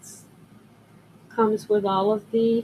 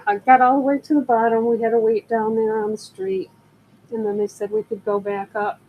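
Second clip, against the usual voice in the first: ordinary talk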